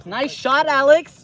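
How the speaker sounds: lightly hammed-up American praise; exaggerated American accent. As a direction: call it out in a mock American accent. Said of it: "That sounds so American".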